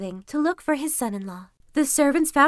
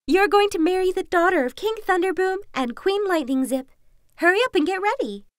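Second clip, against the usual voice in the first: excited fox voice; 9 semitones higher, 4 dB louder than usual. Acted. Fox urges Kuzma.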